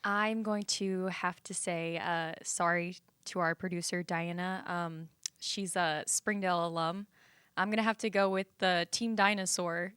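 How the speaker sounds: usual speaking voice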